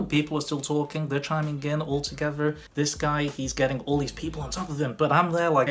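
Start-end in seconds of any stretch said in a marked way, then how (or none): none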